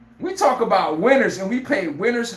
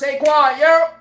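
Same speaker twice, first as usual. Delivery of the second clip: calling out; 7 semitones higher, 5 dB louder, slower than usual. Retold or imitated. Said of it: "Jalen Hurts".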